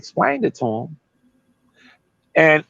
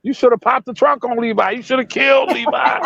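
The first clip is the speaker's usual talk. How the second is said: high, heated retelling of a rant, laughing by the end; mock outrage; 9 semitones higher than usual. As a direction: ham up his crazed rant, cracking up. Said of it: "He went crazy".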